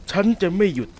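wolf shouting that he won't stop; loud threat. Acted. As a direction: burst out with it.

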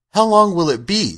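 put-on high-pitched questioning little girl; a hint of a little girl, asking; lightly voiced child. A narrator's reading. Child Dorothy asks Tin Woodman.

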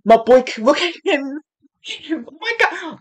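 high, overcome squeal; gushing fan excitement. Real, not acted. Fan seeing their favourite singer appear, gushing squeal. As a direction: squeal adoringly at your idol.

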